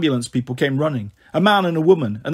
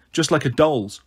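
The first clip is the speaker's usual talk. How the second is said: plain reading voice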